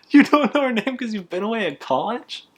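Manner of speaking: high, mocking disbelief; incredulous customer. The customer doubting the son's college excuse, high and mocking. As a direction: squeak out his disbelief like a pushy customer.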